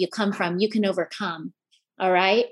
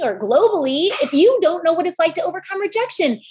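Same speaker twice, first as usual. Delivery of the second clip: raised, rousing preacher delivery; rally the crowd like a sermon; preaching at full force; 5 semitones higher than usual. Acted. Speaker to audience, rousing preaching.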